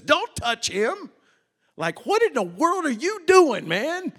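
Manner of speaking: high, loud alarmed cry to stop him; urgent shout. Acted. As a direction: yell to stop the knife. Angel stopping Abraham's knife.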